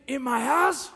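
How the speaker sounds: building from a tense question to a high, loud furious scream; a mob boss roaring at betrayal in his home; screaming Godfather rage. Retold or imitated. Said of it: "he starts screaming".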